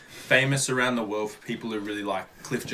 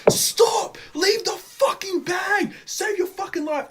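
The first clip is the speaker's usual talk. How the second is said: high-pitched imitated yell at the girl; retold yelling; slower than usual. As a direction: call out high and urgent, half-joking. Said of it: "everyone's yelling and going".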